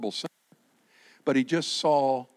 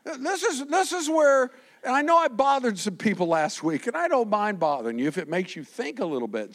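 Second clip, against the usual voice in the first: ordinary talk, nothing marked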